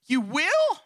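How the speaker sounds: high, incredulous rising question; mock shocked disbelief. Husband hears his wife would remarry, mock shocked disbelief. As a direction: leap up in pitch, playing the stunned husband.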